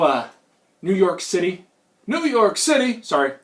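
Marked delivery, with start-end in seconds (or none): 2.1-3.0 salsa commercial catchphrase. Acted. Flashback to a salsa commercial, mock-outraged cry. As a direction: exclaim it like an ad cowboy. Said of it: "Pace Picante flashback".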